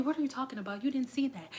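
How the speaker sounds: very soft, collected mock denial; calm gaslighting. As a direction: play innocent in a hushed voice. Acting out a caught Scorpio denying.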